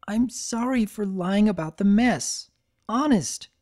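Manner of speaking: a hint of tears in a small apology; tearful apology. Spike apologizing to Twilight, close to tears. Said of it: "lips quivered".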